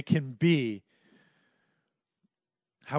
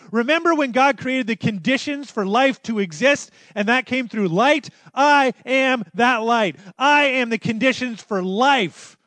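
loud, high preaching climax; full-force preaching; 10 semitones higher, 11 dB louder than usual. Acted. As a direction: proclaim it to the back row. Climax of sermon.

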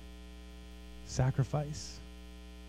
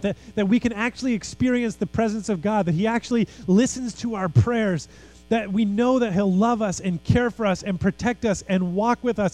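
higher-pitched, urgent sermon flow; fervent preaching; 8 semitones higher, 3 dB louder than usual. Real, not acted. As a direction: preach it with rising passion, phrase upon phrase. Preaches with mounting fervour.

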